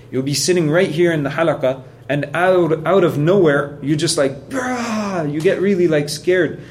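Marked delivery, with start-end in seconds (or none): 4.5-5.3 a sudden high yelp; mock startled outburst. Teacher acting out a sudden fright in the halaqah, high yelp. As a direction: jump as if spooked from nowhere.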